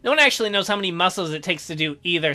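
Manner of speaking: usual speaking voice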